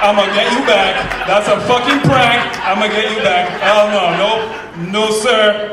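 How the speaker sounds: normal talking voice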